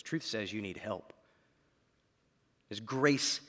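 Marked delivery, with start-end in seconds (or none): none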